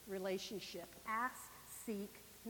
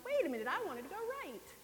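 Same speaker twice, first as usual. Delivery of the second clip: mock protest; 8 semitones higher, faster than usual. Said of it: "I'm going".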